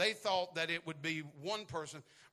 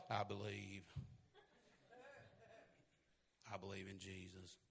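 meek murmur; 10 semitones lower, 17 dB quieter than usual. Retold or imitated. Mocking passive faith quote.

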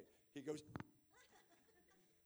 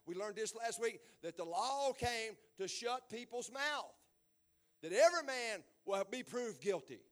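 preaching; 6 semitones higher, 8 dB louder than usual. Acted. Pastor preaching to the congregation at full force. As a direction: preach it hard to the pews.

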